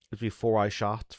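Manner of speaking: ordinary talk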